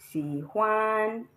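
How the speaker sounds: "xi huan" with huan drawn out; wrong tone. Shown as a demonstration. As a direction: slowly hold huan after a dipping xi. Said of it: "there is no tone".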